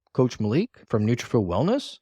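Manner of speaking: "Coach Malik from Nutrafit Wellness" with questioning rises; questioning intonation. Shown as a demonstration. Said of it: "like a question".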